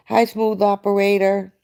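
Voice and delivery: usual speaking voice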